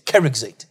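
Keruxate rhyming its ending with "gate"; English pronunciation. Shown as a demonstration. Teacher repeating the word for students, English pronunciation. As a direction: anglicise Keruxate, no sounded final e. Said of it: "if you're gonna pronounce it English".

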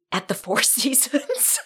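slow, laughing through the words; can't keep a straight face. Real, not acted. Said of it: "can't even say it with a straight face".

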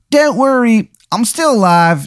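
very high-pitched cartoon character voice; Shithead Steve. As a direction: squeal it out in a put-on falsetto. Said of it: "And he goes".

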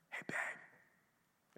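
soft whispered greeting to his wife; a hushed "Hey, babe" at the back of the sanctuary. Retold or imitated. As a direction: whisper it so the service isn't disturbed.

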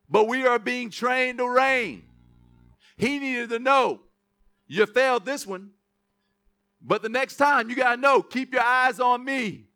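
slow, projected sermon delivery; emphatic preaching. Acted. Preacher voices Jesus teaching Peter, slow emphatic preaching. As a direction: drive the lesson home, stretching the words.

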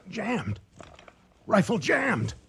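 high, loud screams of panic; terrified shouting. Acted. Hunter with a jammed rifle screaming at the devil, terrified yells. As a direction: shriek it at the beast in the dark.

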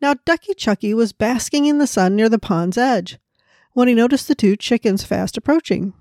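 ordinary delivery, nothing marked

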